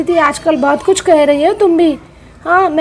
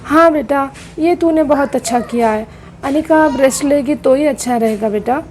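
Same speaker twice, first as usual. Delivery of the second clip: ordinary talk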